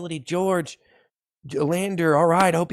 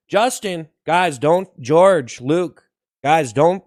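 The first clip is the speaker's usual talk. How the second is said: normal talking voice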